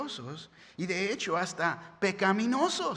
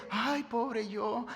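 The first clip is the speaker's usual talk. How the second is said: slow, drawn-out mock whimper of self-pity; hammed-up pitiful sigh; self-pitying whine; 2 semitones higher, much slower than usual. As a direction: stretch the ay like a sorry martyr. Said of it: "no es la idea de".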